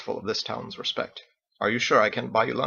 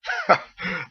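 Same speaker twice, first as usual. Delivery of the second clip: laugh; 7 semitones higher than usual. A narrator's reading. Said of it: "Captain laughed".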